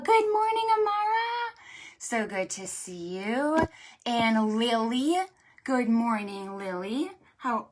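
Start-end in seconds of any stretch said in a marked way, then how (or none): 0.0-1.5 high, loud, bright hello; excited greeting. Real, not acted. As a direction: call out a happy good morning. Greeting a child.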